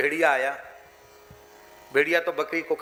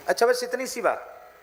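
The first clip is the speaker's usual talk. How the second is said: higher, indulgent "is that all"; lion amused by the goat's small wish, higher; lion's amused surprise; 7 semitones higher than usual. Acted. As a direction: brush it off kindly as a king.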